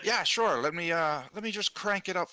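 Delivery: sarcastic squeaky helper; high sarcastic voice. Acted. Player answers joke in mock voice.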